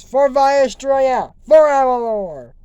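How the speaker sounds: loud drawn-out cheer; rallying cry. Pretend. Crowd answering the princess, loud slow cheer. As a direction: shout it back slowly like a chant.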